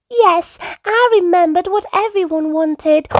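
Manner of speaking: high piglet voice; Peppa. A narrator's reading. Peppa reporting to mum at evening, high piglet. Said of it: "Peppa yawned".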